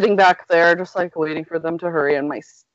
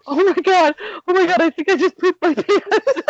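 high, frantic panic at the realization; freaking out; 12 semitones higher than usual. Retold or imitated. Panicking in the back of the van, frantic high squeal. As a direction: squeal it out in sudden horror. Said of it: "Freaking out".

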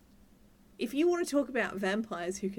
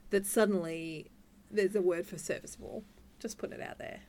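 ordinary talk

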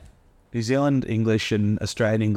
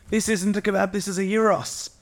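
high-pitched indignant correction; mock correcting; 9 semitones higher than usual. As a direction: imitate a pedant, pitched well up. Mimics someone correcting about kebabs.

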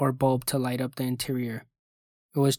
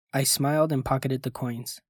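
ordinary delivery, nothing marked

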